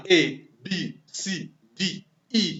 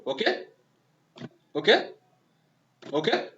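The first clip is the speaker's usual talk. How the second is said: normal talking voice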